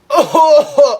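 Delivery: hammed-up coughing; fake cough. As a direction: cough to prove you are sick. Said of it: "Inuubo talaga ako".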